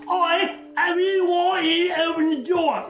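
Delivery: high, slow, shouted mock British accent; bad British accent. GM mocking the player's failed bluff, in a bad British accent. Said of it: "trying to imitate a British accent".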